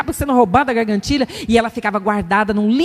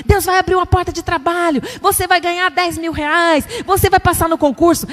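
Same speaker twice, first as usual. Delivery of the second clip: hammed-up prophet, higher and rising to a shout; mock prophecy; 6 semitones higher than usual. Preacher mocking a prophet's promises, building to a shout. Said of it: "Quando alguém chega e fala assim".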